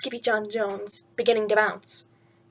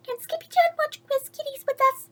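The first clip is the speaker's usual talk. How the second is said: very high, small kitten sister; little girl voice; 14 semitones higher than usual. Acted. Little sister asking mama, high and small. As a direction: ask like a tiny sister.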